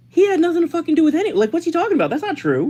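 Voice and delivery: very high, fast indignant protest; mock incredulous denial. Host voices India and Pakistan's denial, high incredulous mockery. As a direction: imitate baffled officials protesting.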